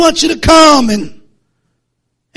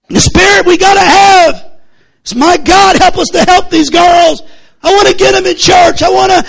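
fervent preaching; 5 semitones higher than usual. Acted. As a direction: cry out to the congregation. Preaching at full force.

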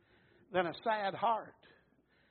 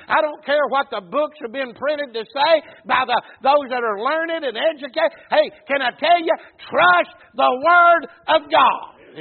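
loud, rising preacher's exhortation; full-force preaching; 4 semitones higher, 10 dB louder than usual. Acted. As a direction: drive it home to the congregation. Preacher urging the congregation.

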